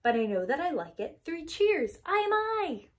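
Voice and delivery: high, loud cheer; shouting. Acted. Child sounding off at the sky, loud cheer. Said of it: "Shout loud at the top of your voice".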